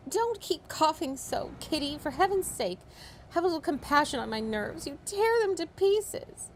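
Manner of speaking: high, fretful scolding mother. A narrator's reading. Mother scolding Kitty for coughing, high and fretful. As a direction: scold her daughter in a shrill-pitched nervous voice. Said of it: "began scolding one of her daughters".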